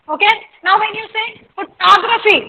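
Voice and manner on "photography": photography as fuh-TOG-ruh-fee; second-syllable stress. Shown as a demonstration. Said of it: "stress कहाँ चला गया". (photography में टॉ पर ज़ोर)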